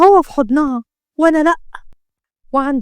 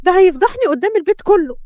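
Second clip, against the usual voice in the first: high-pitched panicked wail; alarmed; 6 semitones higher than usual. A narrator's reading. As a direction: panic about being exposed. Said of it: "سمعوا صوت شهقة شمس". (بفزع)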